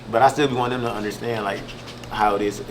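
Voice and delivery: ordinary talk, nothing marked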